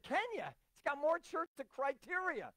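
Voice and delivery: high-pitched, disbelieving retort; incredulous objection. Retold or imitated. Preacher objecting to going to Kenya, incredulous objection. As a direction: retell your astonishment, voice jumping up high. Retold startled objection to pastor's suggestion.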